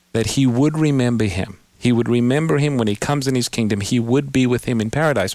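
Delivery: usual speaking voice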